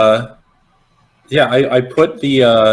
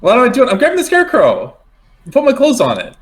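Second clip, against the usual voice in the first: high-pitched exasperated protest; mock frantic; 11 semitones higher, faster than usual. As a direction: blurt it out in a high, flustered voice. Mock-frantic reply to game master.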